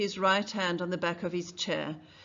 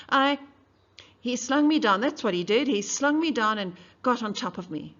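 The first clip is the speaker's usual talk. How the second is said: loud, higher, hammering testimony; loud accusation; 4 semitones higher, 8 dB louder than usual. Acted. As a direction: hit every word, furious.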